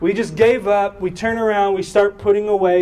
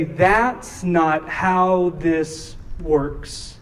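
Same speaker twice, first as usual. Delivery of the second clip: drawn out, word by word; very slow emphasis; 2 semitones lower, much slower than usual. Retold or imitated. Preacher repeating what he told Nora, very slow and deliberate. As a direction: pause between words to drive it home. Repeats consoling line as sermon point.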